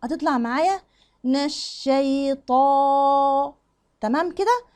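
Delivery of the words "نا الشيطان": نا الشيطان very slowly, nun kept thin and open; slow thin nun demo. Shown as a demonstration. Teacher slowly modelling نا الشيطان for students, thin nun. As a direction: draw out نا الشيطان, nun light before the shin. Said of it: "لو أنا قلت نا مش نا".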